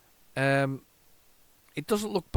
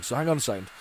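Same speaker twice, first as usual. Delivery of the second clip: ordinary talk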